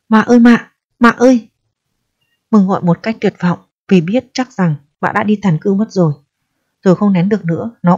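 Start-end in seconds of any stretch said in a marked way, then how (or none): 0.1-1.5 raised, desperate calling for mother; trembling call. A narrator's reading. Boy pounding the door calling for his mother, desperate call. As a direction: call out with rising panic. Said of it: "run rẩy gọi".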